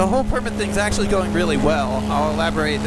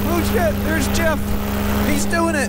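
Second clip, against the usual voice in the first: high, sudden excited call; excited surprise; 11 semitones higher than usual. Real, not acted. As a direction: blurt it out, thrilled to see him. Spots Jeff flying.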